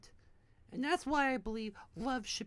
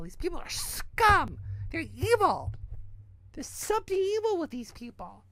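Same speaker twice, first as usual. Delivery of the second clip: loud, raised-pitch condemnation; real angry rant; 5 semitones higher, 5 dB louder than usual. Real, not acted. Ranting about people he says he hates, angry. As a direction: spit out the condemnation, hitting it hard.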